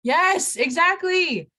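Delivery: high, loud, excited approval; emphatic agreement. Acted. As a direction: jump on the answer with big mock excitement. Cheers an imagined student answer.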